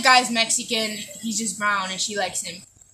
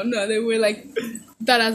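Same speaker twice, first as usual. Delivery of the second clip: ordinary talk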